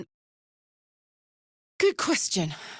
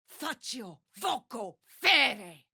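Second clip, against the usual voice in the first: slow, hard battle chant; shouted incantation; 2 semitones higher, much slower than usual. Acted. Warrior casting a spell in battle, shouted slowly. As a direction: hurl the spell words out.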